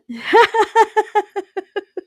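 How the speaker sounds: high, loud laugh through tears; a real, teary burst of laughter; tearful laugh. Real, not acted. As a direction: laugh it out while choking up. Laughs after being teased.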